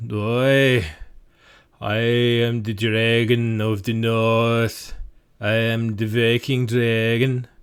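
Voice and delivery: loud, slow, put-on Viking accent for a dragon; bad Viking dragon. GM voicing a dragon for players, slow loud Viking accent. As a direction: boom it out like a cheesy Norse dragon. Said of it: "The dragon says".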